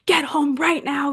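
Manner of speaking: high, forceful retold parental order; imitated dad's scolding; raging dad. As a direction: order them home like an angry father. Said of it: "My dad calls me raging".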